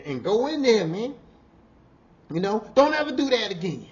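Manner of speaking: high, loud retold scolding; retold yelling. Boss ordering worker into the trailer, loud scolding yell. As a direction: yell at him to go inside and cool off. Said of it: "That was the first time I really yelled at him".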